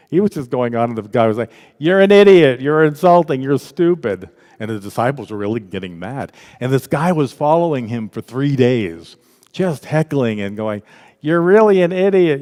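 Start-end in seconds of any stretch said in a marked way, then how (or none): 1.8-4.3 loud, high jab of abuse easing off; mock-angry heckling; heckler's insults. 11.2-12.4 mock heckler, loud and high; jeering, raised-voice imitation; heckler's insult.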